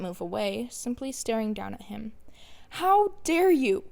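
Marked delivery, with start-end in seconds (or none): 2.8-3.8 furious scream, higher, louder and slowed. Acted. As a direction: hurl it at him with full rage. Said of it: "Izuku screamed".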